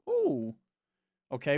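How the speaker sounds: usual speaking voice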